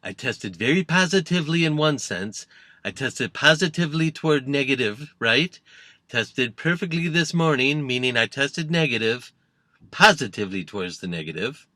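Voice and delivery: lightly put-on voice, rambling and self-assured; Trump. Politician explaining his test result to reporters, Trump impression. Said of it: "Trump announced that he tested negative".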